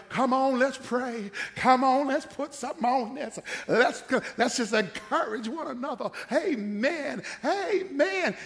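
high, loud, laughing prayer-circle voice; mock churchy encouragement. Church folks stalling with prayer, hammed-up and loud. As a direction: hype each other up in prayer. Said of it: "We over there like".

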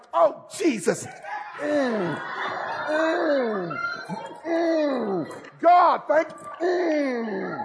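high, drawn-out hums of delight; mock grateful churchgoer. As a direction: savor it like a free burger. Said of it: "Some of y'all be like".

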